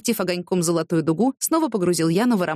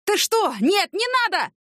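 much higher, frantic pleading shout; panicked cry; 13 semitones higher than usual. Acted. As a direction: beg in terror as the cross comes down. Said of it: "Тот крикнул". (бандит в ужасе кричит, моля о пощаде)

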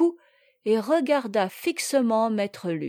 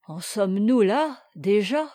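ordinary delivery, nothing marked

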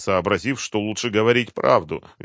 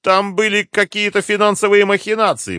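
high, loud anxious excuse; frightened babble; 14 semitones higher, 8 dB louder than usual. A narrator's reading. Threatened captive offers colonel some scrap, babbling. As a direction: blurt it out under threat. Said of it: "пролепетал Юрлов".

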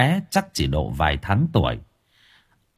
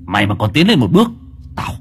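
shouted threat; 7 semitones higher, faster than usual. Acted. Possessed man yelling at the monk to stay back. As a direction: scream the warning.

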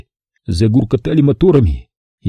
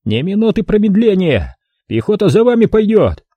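визгливый, высокий крик; кричать, сорвавшись в визг; блажной крик; 9 semitones higher than usual. A narrator's reading. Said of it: "блажным голосом кричал на самоходчика". (блажным голосом кричит)

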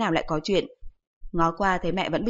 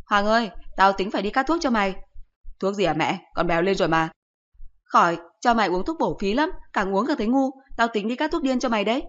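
scolding mother; 2 semitones higher than usual. Acted. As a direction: scold the son like an exasperated mother.

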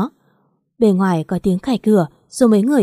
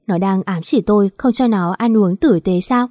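ordinary delivery

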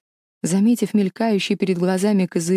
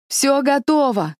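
slow, high altered voice; disguised voice; 8 semitones higher, slower than usual. Acted. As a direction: stretch it out in a strange high voice. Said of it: "послышался изменённый голос Эстеллы".

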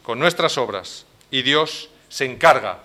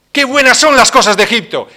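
craving people; 7 semitones higher, 11 dB louder than usual. Retold or imitated. People crave Egypt's things.

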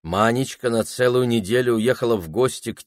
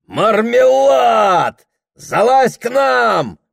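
tipsy shout; 12 semitones higher, 7 dB louder, slower than usual. Acted.

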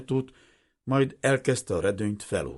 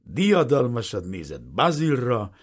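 ordinary delivery, nothing marked

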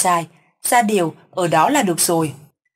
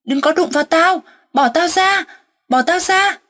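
much higher and louder, fighting off unseen hands; wild angry shout; 10 semitones higher, 4 dB louder than usual. A narrator's reading. Possessed grandfather fighting off captors, panicked angry shouting. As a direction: thrash and scream to be let go. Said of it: "Mắt thì long lên".

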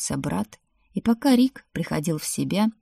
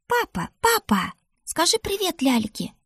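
put-on very high child voice; chattering little daughter; 12 semitones higher than usual. A narrator's reading. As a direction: pipe up to daddy, pleading for attention. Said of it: "загомонила дочурка". (детский голосок, гомоня)

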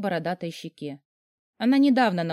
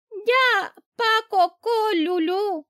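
put-on high, slow baby talk of a little girl; smiling little girl's babble; toddler voice; 13 semitones higher, much slower than usual. A narrator's reading. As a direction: lisp it like a tiny child. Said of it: "улыбнулась моя девочка".

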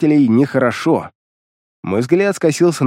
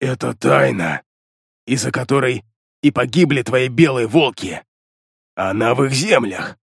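demon voice. A narrator's reading. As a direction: speak like a secretive demon. Demon reveals a secret.

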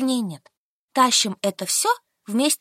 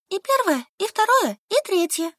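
put-on higher, stubborn young woman; Solka answering; 10 semitones higher than usual. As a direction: answer flatly, digging in. (выше, упрямая Солька)

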